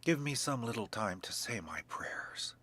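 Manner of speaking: whispered through tears, pleading; tearful whisper. A narrator's reading. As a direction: beg softly in a hushed breathless voice. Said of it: "with eyes all bathed in tears".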